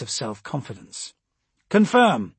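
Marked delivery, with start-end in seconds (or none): 1.7-2.3 loud, raised one-word acknowledgement; radio confirmation. A narrator's reading. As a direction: call it into the comms. Officer confirms over the comms.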